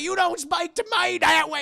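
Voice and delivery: high, loud scolding shout; furious yell. Retold or imitated. Overseer yelling threats at Solomon. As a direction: put him in his place loudly.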